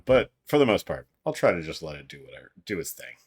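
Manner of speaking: ordinary talk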